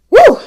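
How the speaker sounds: loud puffing woo to recover; breathless woo. Real, not acted. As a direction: blow out a winded woo. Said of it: "I'm out of breath".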